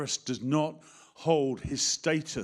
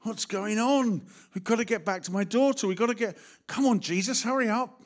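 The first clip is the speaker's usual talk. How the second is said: a frantic father losing patience; exasperated impatience; 6 semitones higher, 3 dB louder than usual. Retold or imitated. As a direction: urge Jesus on, exasperated. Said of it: "Can you imagine Jairus going".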